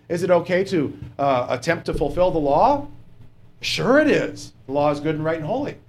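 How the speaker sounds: normal talking voice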